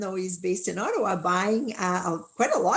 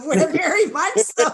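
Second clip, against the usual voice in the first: laughing agreement; 7 semitones higher, 8 dB louder than usual. Real, not acted.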